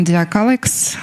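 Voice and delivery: usual speaking voice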